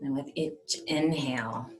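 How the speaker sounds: hushed, unhurried guide into calm; guided meditation. Acted. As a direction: settle them gently, leaving space between phrases.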